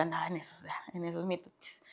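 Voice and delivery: hushed confession; whispered. Real, not acted. Confessing her party days in a whisper. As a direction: whisper like a guilty secret.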